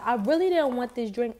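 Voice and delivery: ordinary talk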